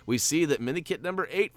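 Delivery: usual speaking voice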